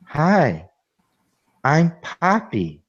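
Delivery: slow, flat robotic doll voice; deadpan robotic greeting; monotone voice. Retold or imitated. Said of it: "she talks in a very monotone, like, voice".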